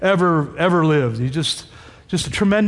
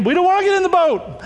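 high-pitched playful objection; comic, high reluctant refusal; mock protest; 10 semitones higher, faster than usual. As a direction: balk like a reluctant follower, pitched up. Acting out disciples refusing Jesus' order.

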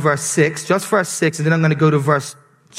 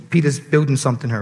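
ordinary talk, nothing marked

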